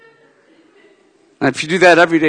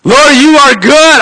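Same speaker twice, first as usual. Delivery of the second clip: loud, slow, high declaration of praise; proclaiming praise; 9 semitones higher, 11 dB louder than usual. Acted. Preacher praising God aloud, proclaiming loudly. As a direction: lift it up to the Lord.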